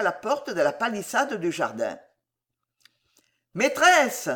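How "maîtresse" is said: high, drawn-out summons; bellowed call. A narrator's reading. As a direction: holler for the mistress of the house. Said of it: "beugla l'homme à la tête rousse". (appel beuglé, voix haut perchée)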